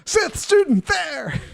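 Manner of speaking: high-pitched, drawn-out alarm yell; hammed-up high alarm call; screaming out. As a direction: stretch it into a high mock scream. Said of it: "So I'm screaming out".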